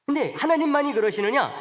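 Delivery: high, loud rhetorical challenge; preacher's rising call to the pews; rousing question. Acted. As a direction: throw the question out to the congregation.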